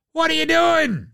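high shout of disbelief; exasperated shout. Real, not acted. Host yelling at a player, high exasperated shout. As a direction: yell it at the screen.

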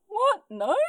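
high, loud baffled questions; mock incredulity. Voicing ferry staff refusing animals.

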